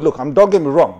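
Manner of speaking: ordinary talk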